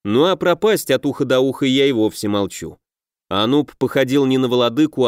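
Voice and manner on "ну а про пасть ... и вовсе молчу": usual speaking voice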